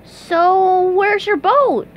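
high, loud cartoon voice; SpongeBob asking. A narrator's reading.

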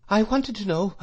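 put-on timid, high-pitched young voice; trembling. A narrator's reading. Frightened boy approaching the dreadful shopkeeper, trembling. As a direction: venture it nervously, pitched well up. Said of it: "I said, trembling".

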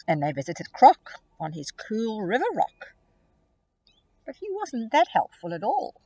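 put-on expressive read-aloud for young children; storybook reading. A narrator's reading. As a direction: read it like a picture book to kids. Rhyming story narration.

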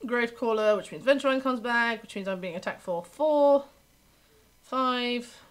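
normal talking voice

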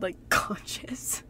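slow, whispered laugh. Real, not acted. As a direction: giggle it out in a breathy whisper. Giggling aside about staying conscious.

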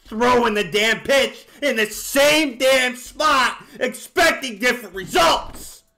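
loud, slowed, fed-up shout; angry rant. Real, not acted. Gamer raging about his own slurve getting hit, loud. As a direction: vent hard at yourself.

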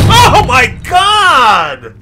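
high, drawn-out exclamation; slow wail of shock; startled cry. Real, not acted. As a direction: cry out at a scare. Jump scare.

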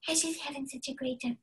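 high, soft, airy voice; sweet cloud character. Shown as a demonstration. Teacher as sweet character up in the clouds, soft high voice. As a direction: float it sweetly and gently. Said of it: "a really sweet character who's up in the clouds".

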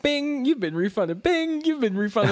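high, sing-out mock phone alert; refund notification. Speaker mimics refund emails arriving one after another, high mock alert voice. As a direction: chirp it like a pinging inbox.

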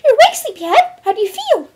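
very high, loud, perky pony squeak; high cartoon voice putting on cheer; Pinkie Pie. A narrator's reading. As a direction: chirp a forced-cheery greeting. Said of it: "the normally cheerful pony asked".

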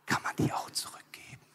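hushed, furtive whispering; conspiratorial whispered request; secretive whisper. Acted. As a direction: whisper it so the partner can't hear. Adam secretly asks God to return Eve.

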